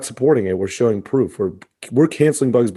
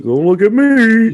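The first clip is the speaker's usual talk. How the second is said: high, slow, mock innocent; Pepe voice; 11 semitones higher, 6 dB louder, slower than usual. Cartoon skunk dodging blame in the locker room, high mock innocence. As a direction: play the skunk denying it, pitch way up. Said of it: "And Pepe like".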